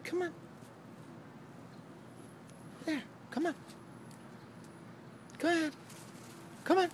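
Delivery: high, soft coaxing call; coaxing a wild bird. Real, not acted. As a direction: coax it out gently.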